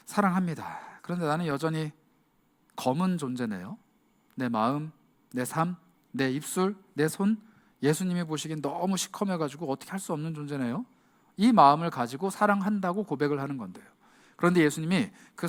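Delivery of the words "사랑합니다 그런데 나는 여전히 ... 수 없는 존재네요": softer, near a whisper, broken; hushed guilty admission; ashamed confession. Acted. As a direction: confess it with your head down. Ashamed Peter answers Jesus.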